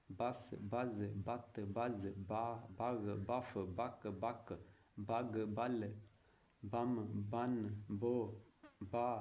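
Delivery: slow, even recitation of each sound; teacher chanting the alphabet sounds; syllable drill. Shown as a demonstration. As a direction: sound out every syllable clearly for pupils. Teacher drills Urdu syllables aloud.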